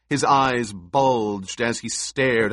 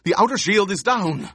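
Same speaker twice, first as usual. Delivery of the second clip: high, loud alarmed shout; urgent shout; 10 semitones higher, 4 dB louder, faster than usual. A narrator's reading. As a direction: yell the alarm. Officer keeps shouting.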